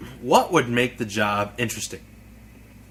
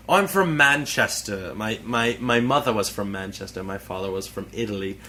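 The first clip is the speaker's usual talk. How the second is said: put-on English accent. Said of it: "if I had done the English dialect".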